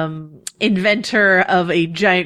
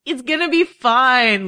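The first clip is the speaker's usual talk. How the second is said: reassuring Margaery; 8 semitones higher, slower than usual. Retold or imitated.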